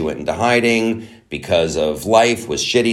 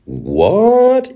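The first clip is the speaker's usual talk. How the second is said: ordinary talk, nothing marked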